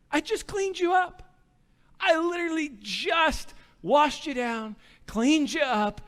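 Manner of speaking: high, strained exasperation; pleading. Retold or imitated. Dad finding just-washed son covered again, exasperated pleading. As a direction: plead in disbelief, spacing out the phrases. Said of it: "like, pleading with you, boy".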